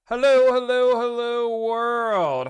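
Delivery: high, loud stretched hellos; booming stream opener; big sing-song greeting. Acted. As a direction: call out hello to the world like an announcer.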